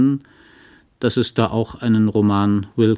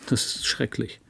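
breathy whisper, voice dropping away; a hushed, stricken whisper; whispered dismay; 2 semitones higher, 8 dB quieter than usual. Acted. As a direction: barely get the words out, ashamed. Husband's halting confession to Carrie.

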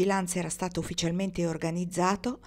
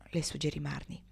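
plain reading voice

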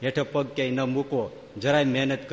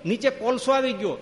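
high-pitched retold cry of warning; imitated alarmed yell; 10 semitones higher, 3 dB louder than usual. Monk warns his swami about hot coal underfoot, imitated yell. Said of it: "સાધુ એ રાડ પાડી ગયા".

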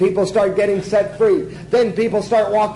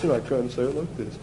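low, very soft delivery; hushed voice; 8 semitones lower, 9 dB quieter than usual. Shown as a demonstration. Preacher showing he can say it small, hushed. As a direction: shrink it down to a low hush.

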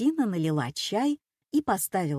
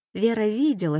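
ordinary delivery

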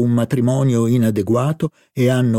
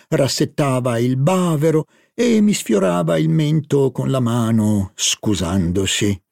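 ordinary delivery, nothing marked

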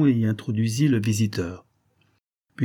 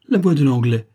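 higher, loud startled exclamation; cry of surprise; 4 semitones higher, 8 dB louder than usual. A narrator's reading. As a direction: cry out in sudden recognition. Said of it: "Saphir jeta un cri de surprise". (cri de surprise)

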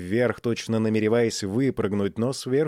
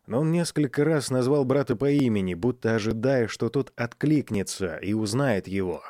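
plain reading voice

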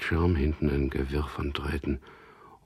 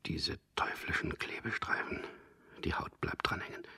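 whisper; 7 dB quieter than usual. Acted.

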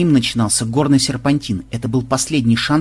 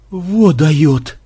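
hoarse remark; 3 semitones higher, 4 dB louder than usual. A narrator's reading. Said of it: "хрипло проговорил кто-то".

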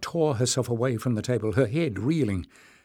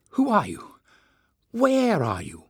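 higher, pressing and loud; urgent questions; 8 semitones higher, 3 dB louder than usual. Acted. Jasmine urgently calling into the crystal. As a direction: demand it breathlessly.

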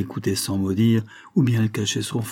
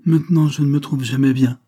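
apologetic tone; 5 semitones higher, 5 dB louder than usual. A narrator's reading. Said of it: "d'un ton d'excuse".

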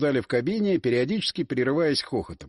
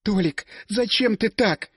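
slow, mildly strained tearful plea; a hint of tears, hurt; 5 semitones higher, slower than usual. A narrator's reading. Squirrel to Tolik, slow and lightly tearful. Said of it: "С надрывом, с явными слезами в голосе прошептала".

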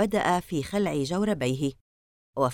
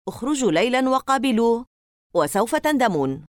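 higher, louder, building warning; angry warning; 5 semitones higher, 4 dB louder than usual. Acted. Larry warns the doubting boys, rising angry. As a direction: dare them to go out.

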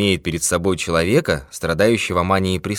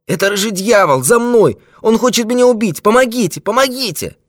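very high, loud cries for help; panicked cry; 7 dB louder than usual. A narrator's reading. Old man begging rescue from the devil, panicked. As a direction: scream out in mortal terror.

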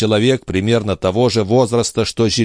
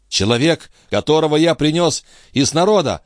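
higher-pitched, anxious assurance; hasty assurance; 8 semitones higher than usual. A narrator's reading. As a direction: assure them eagerly, pitch lifted. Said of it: "поспешно заверил старик". (поспешно заверяя)

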